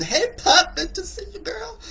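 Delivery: very high squealing hello with a laugh; excited squeal. Real, not acted. A host spots a friend in the chat, squealing with laughter. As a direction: shriek with delight at a friend.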